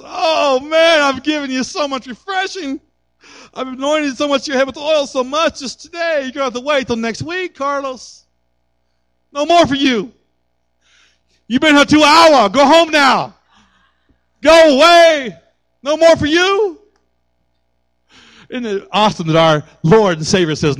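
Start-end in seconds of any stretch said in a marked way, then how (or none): 0.0-8.2 mock weary giver. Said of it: "He never says". 9.3-16.8 rising, louder mock shooing away; hammed-up "go away", pushing him out the door; mock fed-up dismissal. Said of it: "He never says".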